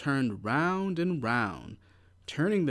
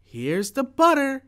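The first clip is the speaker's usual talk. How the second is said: high loud shout; 13 semitones higher, 9 dB louder than usual. A narrator's reading. Jack to his mother with the butter, a high loud shout. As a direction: call it out proudly. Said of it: "shouted Jack".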